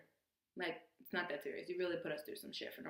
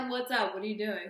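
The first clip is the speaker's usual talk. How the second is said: laughing greeting; 2 semitones higher, 8 dB louder than usual. Retold or imitated. Best friend answering, not believing the jail news, laughing greeting. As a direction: pick up loud and amused, oblivious.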